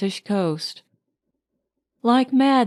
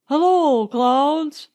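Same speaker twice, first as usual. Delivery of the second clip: cried out; 5 semitones higher, slower than usual. A narrator's reading. Leonard answering Marjorie on the beach, joyful cry. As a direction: call up to the clouds. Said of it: "cried Leonard".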